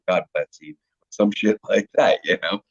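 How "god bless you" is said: a soft, lower sweet God bless you; mock polite blessing. Small-town local greeting a stranger, softly polite. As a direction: play the polite churchgoer, lightly. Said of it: "they'll be like".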